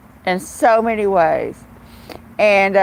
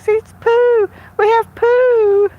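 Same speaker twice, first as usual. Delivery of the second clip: excited; 11 semitones higher than usual. Retold or imitated. Owner cheers over the cat's poo, excited and high. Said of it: "it's like an excitement".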